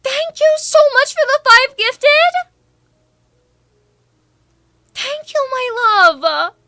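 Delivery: very high, loud squealed thanks; excited thanks. Real, not acted. Streamer thanking a viewer for five gifted, squealing excitedly. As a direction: squeal your gratitude way up high.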